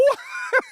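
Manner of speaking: high burst of laughter. Real, not acted. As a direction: laugh at how absurd it was. Laughing at the memory.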